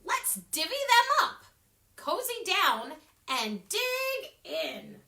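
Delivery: high, loud cheerful badger; high jolly badger; grinning badger. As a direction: invite them like a happy badger. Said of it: "Badger says with a grin".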